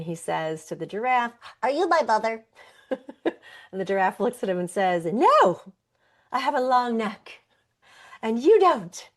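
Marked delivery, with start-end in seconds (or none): 1.6-2.4 higher, louder little-bird plea; storyteller's baby bird asking for mom; small lost bird. Acted. Little bird asks giraffe. 5.0-9.1 much higher and louder, odd giraffe voice. Acted. Giraffe answers bird in story.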